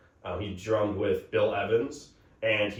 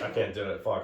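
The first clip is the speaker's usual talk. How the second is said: ordinary talk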